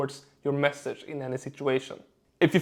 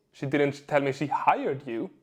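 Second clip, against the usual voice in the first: HIRED lifted out of the sentence; stress on HIRED. Shown as a demonstration. Coach showing how emphasis changes meaning, stress on "hired". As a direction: put the weight on "hired". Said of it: "Same seven words, four completely different meanings".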